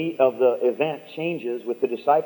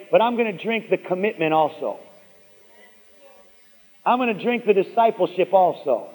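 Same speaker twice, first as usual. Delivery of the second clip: raised, insistent preacher's declaration; rousing preaching; 5 semitones higher than usual. Real, not acted. Preacher leading congregation to declare they drink the whole cup, rousing. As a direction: build a rhythmic list of vows, higher-pitched.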